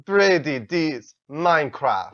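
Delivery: slow, hammed-up Russian accent; mock Russian accent. Reading a follower name aloud in a silly Russian accent. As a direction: draw the name out like a cartoon Russian. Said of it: "give me Russian again".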